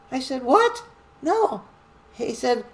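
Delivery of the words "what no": high, loud startled "What?"; surprised. Retold or imitated. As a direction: jump up in disbelief. Surprised reply to ops chief.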